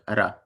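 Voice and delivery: "ra" with one quick tap of the tongue on the r; tapped R. Shown as a demonstration. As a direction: tap the r once in "ra". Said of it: "how you just tap the R".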